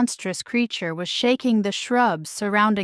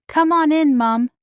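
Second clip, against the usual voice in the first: high, loud call from the water; 7 semitones higher, 6 dB louder than usual. A narrator's reading. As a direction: call your mother in. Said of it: "she called".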